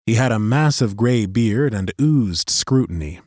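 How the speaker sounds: ordinary delivery, nothing marked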